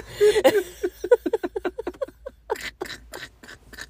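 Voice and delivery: long squealing laugh; loud high laugh. Real, not acted. Laughing at spider image.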